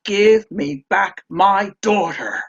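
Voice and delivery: loud, slow, forceful demand; furious father demanding his child back; angry shout. A narrator's reading. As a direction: shout it out slowly over loud music. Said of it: "he shouted out".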